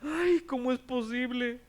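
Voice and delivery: high-pitched, hammed-up shocked wail; mock fright. Preacher mimics how the owner did not react, mock fright. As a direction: play a comically horrified landowner. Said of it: "el dueño de la hacienda no se asustó".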